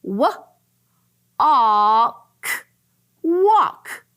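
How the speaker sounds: segmented W, a, k then walk; sounding out. Shown as a demonstration. Teacher blending walk for first graders. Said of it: "sounding out word".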